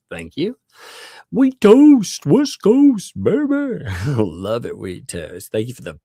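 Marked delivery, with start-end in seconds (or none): 1.3-3.8 very high, loud, stretched-out shout-out; drawn-out high whoop of a greeting; playful hype shout. Pretend. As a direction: holler it slowly like a hammed-up hype man. Playful shout-out of member's name.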